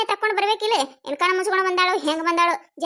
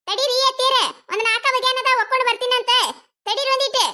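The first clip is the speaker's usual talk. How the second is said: high, raised call to mother-in-law; pitched-up, impatient reply; calling back impatiently; 6 semitones higher than usual. Acted. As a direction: put her off from across the house. Daughter-in-law calls back to mother-in-law.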